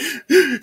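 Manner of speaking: high, sharp intake of breath; imitated sobbing gasp. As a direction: mimic a brother gasping in bed. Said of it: "he was, like".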